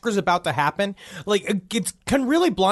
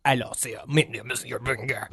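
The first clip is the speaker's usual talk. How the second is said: softer, rehearsing the line to the mirror; mirror practice; 6 semitones lower, 5 dB quieter than usual. Shown as a demonstration. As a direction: practice the name quietly, as if in the mirror. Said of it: "practice it like that in the mirror".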